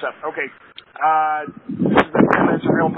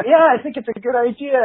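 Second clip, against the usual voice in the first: put-on chirpy girlfriend; 8 semitones higher than usual. Girlfriend voiced brightly in story.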